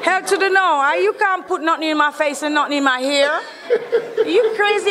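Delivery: high-pitched, incredulous scolding through laughter; laughing indignation. Real, not acted. Guest rejects the host's explicit proposal, laughing indignation.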